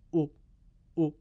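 او said short, one beat; short vowel demo. Shown as a demonstration. Teacher demonstrating the short damma او to students. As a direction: clip او to a single beat. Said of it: "صدای کوتاه تک ضربی".

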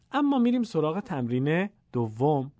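ordinary talk, nothing marked

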